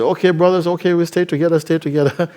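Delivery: high, loud call to stick together; eager rallying call. Acted. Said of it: "they said".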